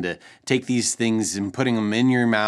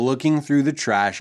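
usual speaking voice

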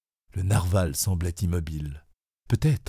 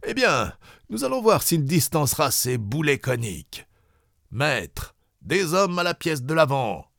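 higher, commanding captain voice; commander's orders; 6 semitones higher than usual. Acted. Farragut ordering the bow gun manned, commanding. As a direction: bark orders at the gun crew.

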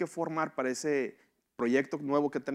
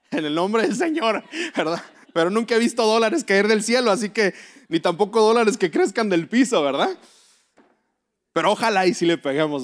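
joking preacher burst; 7 semitones higher, 8 dB louder than usual. Acted. Joking about money from heaven.

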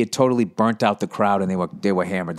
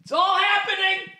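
much higher, hushed glee; silent excitement. Retold or imitated. Young comic thrilled alone in his apartment, squealing it under his breath. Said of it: "screaming in silence".

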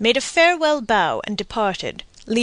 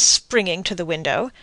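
plain reading voice

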